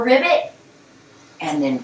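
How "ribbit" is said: higher, light ribbit; one last playful frog croak. Shown as a demonstration. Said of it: "And one more".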